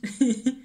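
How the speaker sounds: short delighted laugh; a burst of pleased laughter; bright laugh. Real, not acted. Pleased with her discovery, a short happy laugh.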